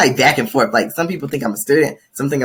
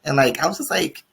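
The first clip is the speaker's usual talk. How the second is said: ordinary talk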